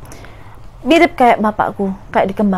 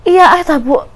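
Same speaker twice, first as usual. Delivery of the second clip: frightened insisting; 6 semitones higher than usual. Retold or imitated. Daughter insisting mother come now, frightened. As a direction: insist desperately.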